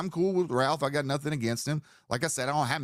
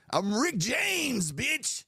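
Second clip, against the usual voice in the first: [name] impression; 10 semitones higher, much slower than usual. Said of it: "McFly says".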